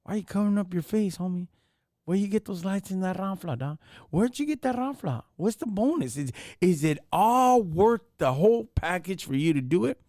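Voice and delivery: calling out Garcia to his face, voice up; imagined confrontation. Pretend. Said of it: "I would have been like".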